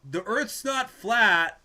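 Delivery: loud, high, slow parting shot; indignant retort. Retold or imitated. The other guy's last word, loud and slow. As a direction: call it back across the room, offended. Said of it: "he says".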